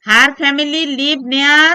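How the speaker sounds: very slow, drawn-out prompt; slow teacher prompt, rising. Shown as a demonstration. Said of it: "prompting student slowly".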